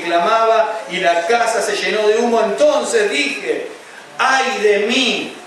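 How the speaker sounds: high, loud, slow pulpit cry; preaching at full force. Acted. Preacher crying Isaiah's vision to the congregation, full-force preaching. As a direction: cry out the prophet's terror to the hall.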